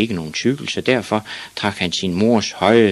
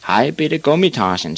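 lightly acted bully, pitched up; big boy's higher, taunting call; teasing big boy; 8 semitones higher, 3 dB louder than usual. A narrator's reading. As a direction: hail a little kid mockingly. Big boy teasing small Ivan.

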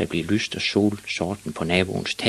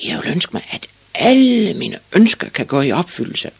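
put-on high, loud boyish shout; shouted wish; 11 semitones higher, 7 dB louder than usual. A narrator's reading. Ivan announces his wish to the sleepy witch, shouting. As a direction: call it out, swelling on "alle". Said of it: "råbte Ivan Olsen".

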